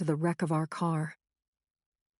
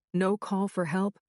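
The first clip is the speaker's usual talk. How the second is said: ordinary delivery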